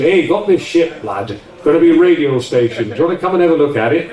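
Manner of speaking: lightly doing his Yorkshire mate on the phone; Yorkshire accent. Retold or imitated. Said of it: "he said in his, uh, Yorkshire accent".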